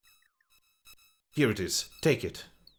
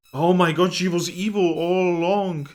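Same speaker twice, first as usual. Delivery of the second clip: loud, high "oh my god" at the twist; sudden loud gasp of shock; shocked outburst; 7 semitones higher, 9 dB louder than usual. Real, not acted. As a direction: blurt it out in disbelief. Streamer reacts to game twist.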